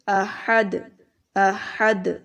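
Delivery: अहद ending with a bounced, echoing dal; qalqala on dal. Shown as a demonstration. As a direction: shake the final द of अहद so it rings. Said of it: "तो इसको ऐसे पढ़ेंगे". (अहद में दाल पर कलकला)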